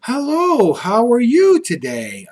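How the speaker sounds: high, slow sugary hello; fake-polite little girl; overly pleasant. A narrator's reading. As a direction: sweeten it up to dodge trouble. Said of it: "I smiled very pleasant".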